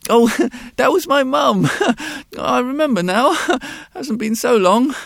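put-on high child's voice laughing through words; a caught boy giggling guiltily; giggling little boy. A narrator's reading.

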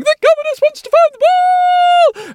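very high, loud, fussy squeal; a flustered assistant squealing; fussing assistant. Acted. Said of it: "assistant was fussing over".

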